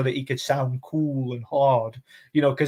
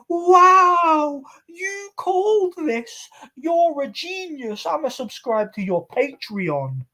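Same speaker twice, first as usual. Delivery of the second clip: much higher, slow, gushing fanboy voice; mock awestruck fan; much slower than usual. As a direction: coo like a starstruck follower. Mocking fans in the comments.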